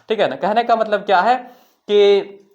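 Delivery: ordinary talk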